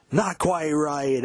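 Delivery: a drawn-out, milder stage cry; live-take cry. Retold or imitated. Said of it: "Live version from seventy-nine".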